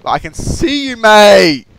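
high call rising to a loud "mate". Real, not acted. Gamer calling out a rival in sight, taunting holler. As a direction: holler at the player you spotted.

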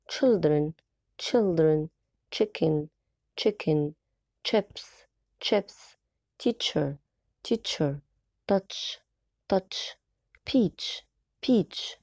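put-on each word twice with a crisp "ch" in "Children"; repeat after me. Shown as a demonstration. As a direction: drill every word twice, landing on the ch. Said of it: "السي اتش بنطقها cha". (تكرار كل كلمة مرتين بنطق تش واضح)

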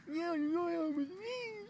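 very high mock female whine; mocking ex girlfriend. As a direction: squeak her line in falsetto. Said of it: "And I'm like".

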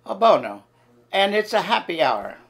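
a hammed-up Italian accent, serving with gusto; Luigi Italian accent. Waiter Luigi welcoming diners in an Italian accent. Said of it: "Listen and learn".

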